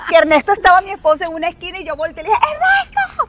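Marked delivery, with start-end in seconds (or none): 2.5-3.2 high, loud call across the room; excited shouting. Retold or imitated. Telling her husband across the room, shouting with joy. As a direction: yell the news to him across the room. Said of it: "pero fue así, a los gritos".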